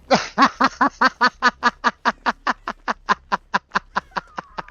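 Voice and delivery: high loud burst of laughter. Real, not acted. Streamer laughing loudly at her own math fail. Big laugh after failing.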